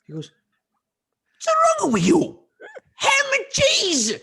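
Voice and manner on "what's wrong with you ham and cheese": high, loud mock yell; retold father's outraged shouting; furious father. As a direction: bellow it slowly like an exasperated dad.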